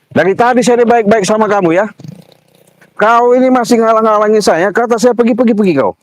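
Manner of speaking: high, exasperated telling-off; angry scolding. Real, not acted. Driver losing patience with the blocker, high angry scolding. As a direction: scold him and order him off, pitched high.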